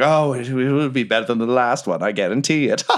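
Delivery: lightly voiced host playing to flatterers; a hint of a boastful hostess; slightly put-on grand voice; Duella. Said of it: "And she's like".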